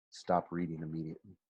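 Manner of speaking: plain reading voice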